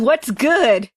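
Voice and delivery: usual speaking voice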